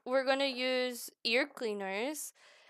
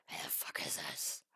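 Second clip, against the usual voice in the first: breathy repeat whispered to herself; 13 dB quieter than usual. Shown as a demonstration. Crafting streamer whispers the question again in disbelief, whispering. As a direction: whisper the line right after saying it aloud. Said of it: "'cause what the fuck is this?".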